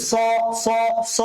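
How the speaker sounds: স repeated with heavy rounded vowel; thick s drill. Shown as a demonstration. Teacher shows students how to pronounce, heavy স. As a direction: say each স thickly, separated by pauses. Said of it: "এভাবে উচ্চারণ করবেন".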